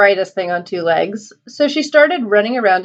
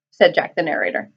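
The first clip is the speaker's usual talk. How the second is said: ordinary delivery, nothing marked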